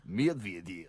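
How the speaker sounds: low, deep-pitched Russian accent. Shown as a demonstration. Showing co-host the name, deep Russian accent. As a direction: drop the voice right down into a Russian accent. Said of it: "say it deeper ... put on the Russian accent".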